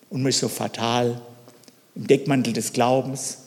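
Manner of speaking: ordinary talk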